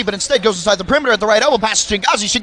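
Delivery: rapid-fire auctioneer patter; auctioneer pace. Acted. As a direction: rattle it off like an auctioneer. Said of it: "I sound like an auctioneer".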